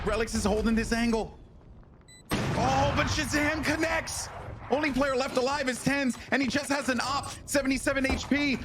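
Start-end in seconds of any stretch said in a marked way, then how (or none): 2.3-8.5 high, excited clutch call; esports caster. Real, not acted. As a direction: ride the tension of the final duel. Live play-by-play climax.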